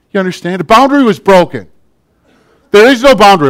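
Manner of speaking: preacher rising to a loud, high peak; emphatic preaching. Real, not acted. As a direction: hammer the point home to the congregation. Preacher driving home the point.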